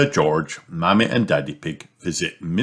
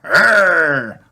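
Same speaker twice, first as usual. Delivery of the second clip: high, loud little roar; toddler dinosaur roar; 11 semitones higher, 6 dB louder than usual. A narrator's reading. George roaring back at Mister Dinosaur. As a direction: roar like a happy piglet playing dinosaur. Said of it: "replies George".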